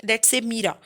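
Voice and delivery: ordinary talk, nothing marked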